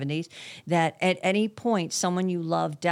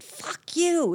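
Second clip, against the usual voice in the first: imitating a cheeky son, much higher; defiant son; 13 semitones higher than usual. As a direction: toss it off like a defiant teenager. Retold son defying his father.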